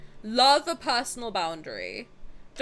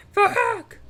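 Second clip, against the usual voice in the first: sudden high, loud curse; startled shout; 10 semitones higher, 8 dB louder than usual. Real, not acted. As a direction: yell it in one burst. Demonstrates how loud it is.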